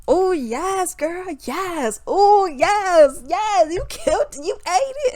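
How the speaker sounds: high, loud mock hype; gushing fan. Mocking fans in the comments, high gushing praise. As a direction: squeal approval like an over-the-top fan.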